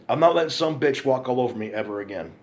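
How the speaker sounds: ordinary talk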